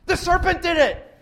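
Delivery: loud, high, defensive blurt; acted outburst of accusation; blame-shifting. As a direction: pin it on someone else, fast and forcefully. Eve passing blame to serpent.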